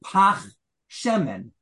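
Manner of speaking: "pach shemen" said crisply, with a throaty ch in "pach"; slow Hebrew demo of "pach shemen". Shown as a demonstration. Rabbi showing students how to say it in Hebrew, careful. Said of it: "I would say".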